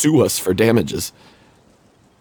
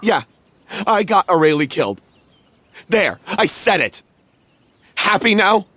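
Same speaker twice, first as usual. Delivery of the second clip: bitter outburst; 7 semitones higher than usual. Acted. Bitter confession to accusing friend.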